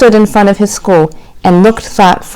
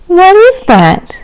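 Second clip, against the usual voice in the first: put-on higher, slow, wide-eyed child's question; little raccoon asking his mother, higher and slower; eager question; 9 semitones higher, slower than usual. A narrator's reading. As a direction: ask with eager curiosity. Curious child asks mother.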